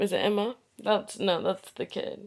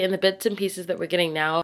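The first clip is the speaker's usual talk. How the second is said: usual speaking voice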